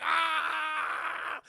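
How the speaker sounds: long, high, loud scream; sustained scream of someone being fried; electrocution scream. Acted. Streamer acts Dick Miller being electrocuted, long scream.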